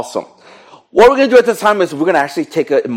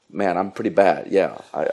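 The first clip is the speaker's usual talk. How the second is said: downcast admission; 7 semitones lower, 7 dB quieter than usual. Acted. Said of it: "some of you are like".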